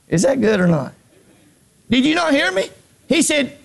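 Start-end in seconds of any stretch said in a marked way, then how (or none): 1.9-3.6 high, insistent preacher's cry; fervent, raised-pitch sermon; full-force preaching. Real, not acted. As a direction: demand that the congregation hear you.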